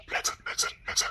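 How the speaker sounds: very soft, breath-only hush; soothing whisper. Acted. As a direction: whisper them calm. Husband soothes crying wife.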